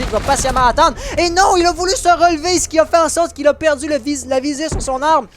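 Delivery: high, loud rush of play-by-play as the kill lands; excited climax call. Real, not acted. Caster calling the decisive kill to chat, thrilled and loud. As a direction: ride the peak of the clutch, voice soaring.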